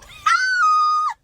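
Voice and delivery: high, loud shriek of disbelief; shrieking laugh-cry. Real, not acted. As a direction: let out a wild exasperated shriek. Exasperated outburst.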